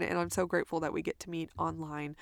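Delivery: usual speaking voice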